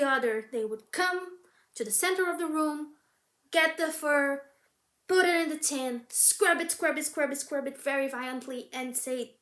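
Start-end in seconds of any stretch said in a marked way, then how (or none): none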